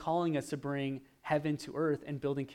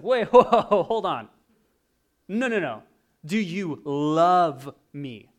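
startled Jesus; 2 semitones higher, 11 dB louder, slower than usual. Retold or imitated. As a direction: stop him short in disbelief. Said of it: "And Jesus is like".

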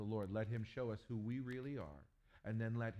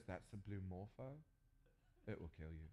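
low, softer matter-of-fact Aussie host; deadpan warning; 3 semitones lower, 8 dB quieter than usual. Retold or imitated. Host warning about the butterfly, deadpan. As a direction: say it flatly low like it's nothing. Host's calm warning again.

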